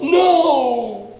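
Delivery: put-on high, loud cry of a little boy protesting; imitating a little boy's loud high protest; child crying out. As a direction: shout it out like a small child in the pew. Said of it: "the little boy, cries out".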